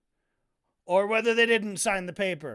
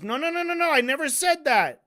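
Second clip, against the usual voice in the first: mock angry protest, rising higher and louder; pissed-off denial; 5 semitones higher, 5 dB louder than usual. Debater hotly denies what he said, mock pissed. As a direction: imitate him loudly objecting. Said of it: "now he's fucking pissed. He goes".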